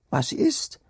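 high, eager calls of startled maids; calling maids. Acted. Maids calling out to the returning servants, high and eager. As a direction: call out to the returning servants, urgent. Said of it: "riefen die andern Dirnen".

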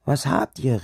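high, eager calls of startled maids; calling maids. Acted. Maids calling out to the returning servants, high and eager. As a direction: call out to the returning servants, urgent. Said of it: "riefen die andern Dirnen".

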